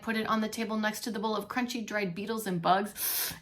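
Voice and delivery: ordinary delivery, nothing marked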